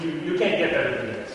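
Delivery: ordinary talk, nothing marked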